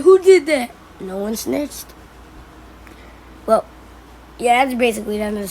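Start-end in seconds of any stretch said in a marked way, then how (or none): 0.0-0.7 loud, high demand; raised-pitch imitation of an indignant shout; retold outcry. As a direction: shout it like the kicked boy. Kicked boy demanding who did it.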